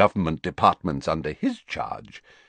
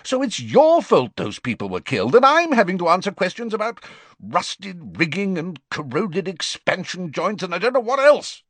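louder, heated accusation; the Prime Minister blames Fudge for the deaths, furious; 12 semitones higher, 4 dB louder than usual. Acted. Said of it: "said the Prime Minister furiously".

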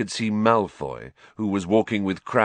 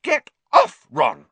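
much higher, loud snap; angry shout; 8 dB louder than usual. Acted. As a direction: shake him off in fury. Hermione snapping.

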